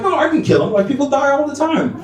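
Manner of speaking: high-pitched imitation of an innocent child; little girl. Eight-year-old pupil defends drawing to teacher, innocent. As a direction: explain it matter-of-factly in a put-on child's voice.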